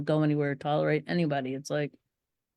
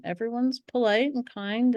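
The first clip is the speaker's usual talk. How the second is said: much higher, sugary mock-soothing voice; mock reassurance; 8 semitones higher than usual. Speaker calming the standing audience, in a high mock-sweet voice. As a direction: coo at them to fake calm, pitched way up. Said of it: "I was like".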